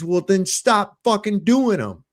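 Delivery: high, slow, emphatic comeback; retold exasperated retort. Father answering supervisor on traffic stops, retold exasperated retort.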